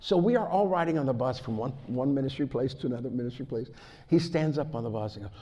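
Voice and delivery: loud, high, driving sermon delivery; preaching at full force. Acted. Preacher rousing his church with stories of bold faith, full-force preaching. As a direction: fire up the congregation.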